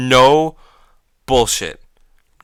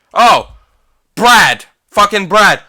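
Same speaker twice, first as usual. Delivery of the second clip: much higher, louder fed-up cry; real annoyance, raised high and loud; exasperated outburst; 9 semitones higher, 8 dB louder, slower than usual. Real, not acted. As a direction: yell at the friend who interrupted. Friend interrupts the recording.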